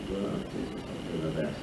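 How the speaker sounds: grudgingly promising the doctor under his breath; mumbled reply. Retold or imitated.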